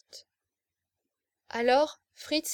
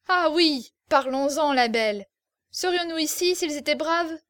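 loud, higher jeering taunt; mocking laughter in the voice; 4 semitones higher, 6 dB louder than usual. Officer mocking the girl over the French, loud jeer. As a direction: sneer at her, enjoying it. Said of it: "Puis il se mit à rire".

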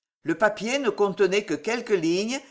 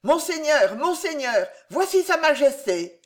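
high, louder urgent call; man calling out; 8 semitones higher, 4 dB louder than usual. Acted. A servant bursting in to announce the king: high louder call. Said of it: "un homme cria".

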